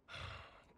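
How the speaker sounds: soft, tired exhale; weary sigh. Acted. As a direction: let out a heavy sigh. Bitter aside after assassination attempt.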